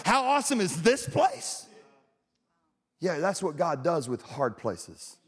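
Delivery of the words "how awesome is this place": high, rising disbelief on THIS place; incredulous question. Acted. Preacher questions praising a hard place, incredulous. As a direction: ask it in mock disbelief. Repeats verse as doubter's question.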